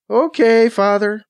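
sighing. A narrator's reading. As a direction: say it with a hint of a sigh. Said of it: "hears the sigh in her voice".

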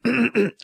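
short throat clear before speaking; preparatory ahem. A narrator's reading. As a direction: clear your throat to begin. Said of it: "clears his throat to speak".

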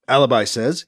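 ordinary delivery, nothing marked

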